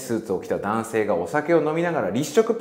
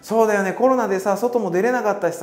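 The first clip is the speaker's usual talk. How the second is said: much higher and louder, gushing reunion chatter; excited catching up; 12 semitones higher, 4 dB louder than usual. Pretend. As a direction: pour out how much you missed them. Excited reunion chat across room. (久々の再会ではしゃぐ高い声)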